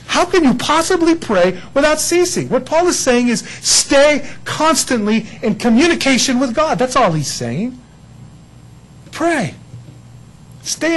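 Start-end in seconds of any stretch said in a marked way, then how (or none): none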